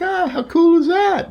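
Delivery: high, drawn-out awe; retold thrilled reaction. Fan reacting to a gift from a man in line, thrilled. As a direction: gush it in a high voice, stretching the words.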